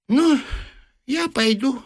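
put-on high, offhand old man; old man leaving, high and indifferent. A narrator's reading. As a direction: toss it off casually. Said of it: "сказал равнодушно старичок".

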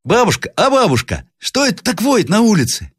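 put-on very high child's voice; young boy. A narrator's reading. Grandson asks grandmother about howling outside, piping child voice. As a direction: pipe up like a curious little boy.

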